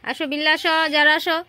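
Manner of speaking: high loud sing-song call; calling pets to dinner. Real, not acted. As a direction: call the cats in to eat. Calling cats to eat.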